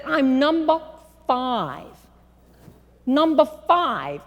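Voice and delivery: put-on Northern city accent. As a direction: repeat it in a brash Long Island voice. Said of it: "thick, big city Northern accent".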